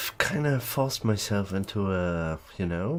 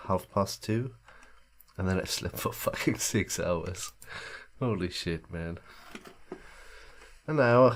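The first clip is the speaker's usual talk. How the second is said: ordinary talk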